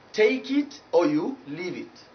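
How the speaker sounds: "Take" with full gliding ay; diphthong ay. Shown as a demonstration. Teacher repeating the correct saying, diphthong ay. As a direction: glide the vowel in "Take". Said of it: "not take it or leave it".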